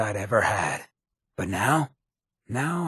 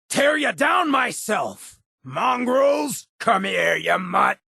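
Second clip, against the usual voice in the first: combat shout; 12 semitones higher, slower than usual. Acted. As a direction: roar taunts at the animal to draw it in. Combat taunts at attacking beast.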